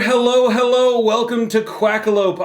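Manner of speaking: bright, high show-host greeting; host welcome. Acted. Host greets Jamie and the viewers, in a high announcer voice. Podcast host's opening welcome.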